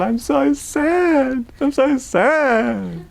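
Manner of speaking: put-on high, slow, weepy child voice; tearful child. Son crying to his parents on Christmas night, high slow whimper.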